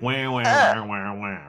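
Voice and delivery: high mock-whiny wah wah wah; mock whining wah wah. Employee hearing the but, mock whining wah wah. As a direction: whine it like a sulking employee. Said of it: "then you're like".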